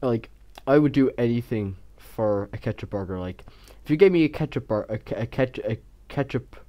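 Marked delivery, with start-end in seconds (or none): 3.2-6.6 tripping over the words; stumbling, restarting mid-word; stuttering. Real, not acted. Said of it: "I'm stuttering".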